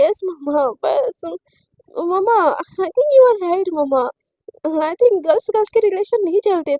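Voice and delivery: choked up. Acted. Shivani forcing herself to agree.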